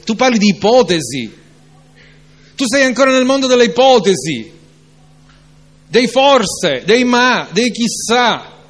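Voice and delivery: fervent preacher rebuking doubt; preaching at full force. Acted. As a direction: build the sermon to a passionate peak. Preacher's rising climax.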